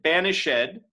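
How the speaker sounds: "Banished" in three syllables, ban-ish-ED, rising; accented ED. Shown as a demonstration. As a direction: sound the ED of "Banished" as its own syllable. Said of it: "has an accent on that E D".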